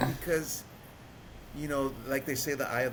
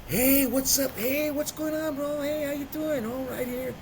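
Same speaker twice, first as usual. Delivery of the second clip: hyped phone greeting. Retold or imitated. Calling a friend from the Greyhound, excited. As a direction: act out an over-eager greeting to a buddy.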